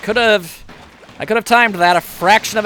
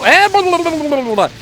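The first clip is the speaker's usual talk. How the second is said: mocking blah blah; 10 semitones higher, 5 dB louder than usual. Mocking the game's instructions.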